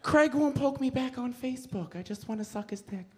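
mock the friend's pining; lightly camp whining friend.

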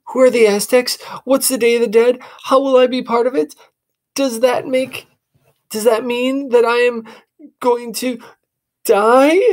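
put-on high, worried young boy; fearful questions tumbling out; boy asking his sister in fear; anxious boy. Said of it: "asked anxiously".

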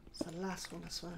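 ordinary talk

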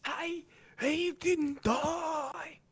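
high, slow, mock-angry New Zealand yell; Kiwi accent. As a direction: imitate a furious Kiwi, drawn out. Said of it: "With a thick Kiwi accent".

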